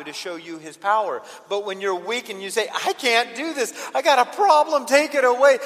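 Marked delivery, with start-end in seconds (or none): 2.7-5.6 loud, high, mounting cry for help; desperate plea. Acted. Weak believer calling out to God, desperate. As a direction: build to a shouted appeal.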